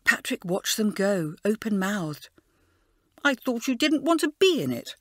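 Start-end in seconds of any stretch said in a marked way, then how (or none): 3.2-4.9 higher, mildly cross accusation; a hint of petulant crossness; crossly. A narrator's reading. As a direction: call after them, put out. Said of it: "he said crossly".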